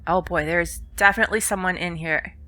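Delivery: ordinary talk, nothing marked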